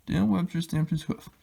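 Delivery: plain reading voice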